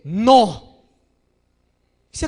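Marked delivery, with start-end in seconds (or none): 0.0-0.8 firm father no. Retold or imitated. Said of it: "si el padre es firme, le dice".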